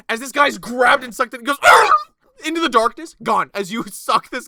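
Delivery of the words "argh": loud dying scream dragged away. Acted. Guard grabbed by monster, loud scream. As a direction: cry out as you're yanked into the dark.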